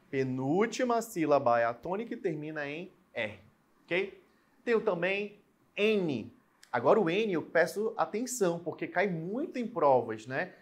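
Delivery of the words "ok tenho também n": ordinary talk, nothing marked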